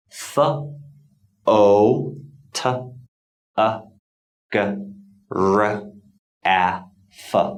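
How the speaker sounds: "F O T O G R A F" as separate phonetic sounds, not letter names. Shown as a demonstration. Teacher reading the phonetic symbols, "F O T O G R A F" sounded out separately. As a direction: sound out "F O T O G R A F" one isolated sound at a time, pausing between. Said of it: "the exact pronunciation of the word photograph".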